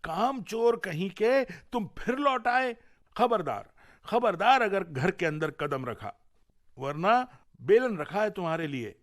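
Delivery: wife screaming at her lazy husband from afar; angry shriek. Acted. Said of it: "वो चीखी".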